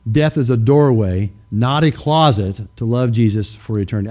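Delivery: slow, deliberate restatement of Death is a doorway; slow repeat. Shown as a demonstration. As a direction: let each word of "Death is a doorway" land slowly.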